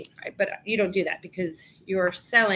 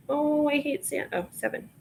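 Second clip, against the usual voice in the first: ordinary talk, nothing marked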